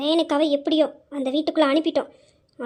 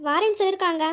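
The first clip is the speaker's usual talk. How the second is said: usual speaking voice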